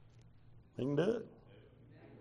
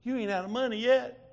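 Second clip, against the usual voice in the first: a hint of a higher divine voice; voice of the Lord; 8 semitones higher than usual. Preacher quoting the Lord's promise, lightly voiced. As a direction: let God's reply ring a little. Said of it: "then he said this to me".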